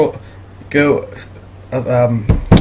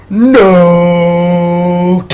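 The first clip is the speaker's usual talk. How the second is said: long drawn-out, louder "no"; mock horrified cry; 3 semitones higher, 5 dB louder than usual. Realising his hand is aimed at someone, mock horrified cry. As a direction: stretch it out in exaggerated horror.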